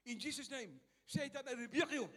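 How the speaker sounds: loud, high commanding rebuke; forceful rebuke. Retold or imitated. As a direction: cast it out with authority. Rebuking a spirit in prayer. (malakas na pagsaway)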